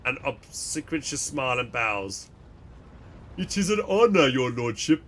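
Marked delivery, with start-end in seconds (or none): 3.4-5.0 oily, self-important merchant voice; pompous fat man. Acted. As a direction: fawn over the captain pompously. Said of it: "fat and pompous".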